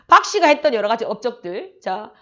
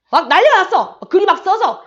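신난 외침; 7 semitones higher, 6 dB louder than usual. Real, not acted. 시험 장면을 신나게 얘기함.

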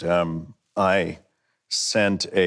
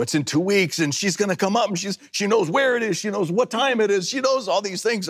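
panicked friend; 12 semitones higher than usual. Retold or imitated. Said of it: "He's like".

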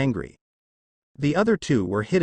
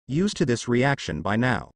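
plain reading voice

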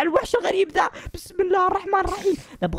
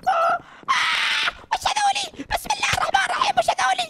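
much higher panicked shouting cries; high, shrieking frantic pleading; terrified; 10 semitones higher than usual. Acted. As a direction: scream for help as they swarm you. Attacked by bugs, begs Mary.

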